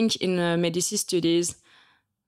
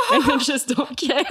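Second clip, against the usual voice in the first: giggling through the words, higher; laughing; 8 semitones higher, 5 dB louder than usual. Real, not acted. Telling her host she doesn't care, laughing. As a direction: laugh it off, carefree. Laughing about not caring.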